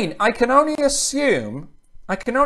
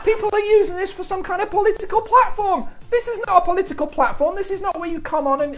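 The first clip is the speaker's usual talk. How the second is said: high, fast telling-off; indignant, hurried complaint; exasperated rant; 7 semitones higher, faster than usual. Real, not acted. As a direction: scold the viewers in a raised pitch, rushing on. Rants at political comments.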